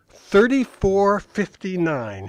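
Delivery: slow, proud "Thirty-four fifty-nine" with heavy F's. Retold or imitated. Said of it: "heavy on the F's for emphasis".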